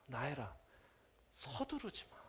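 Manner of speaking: softly spoken, gently holding him back; gently rebuking. Acted. 예수님이 서두르는 나다나엘을 부드럽게 꾸짖듯이. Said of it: "부드럽게 꾸짖으시는 거예요".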